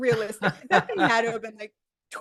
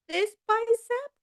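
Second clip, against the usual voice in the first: high, slow sceptical question; mock disbelief; 9 semitones higher, slower than usual. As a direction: stretch it out doubtfully.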